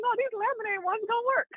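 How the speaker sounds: high, dismayed protest; mock protest. Telling the FedEx clerk laminated flyers won't do, high mock dismay. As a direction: squeak out the complaint.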